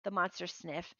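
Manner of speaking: ordinary delivery, nothing marked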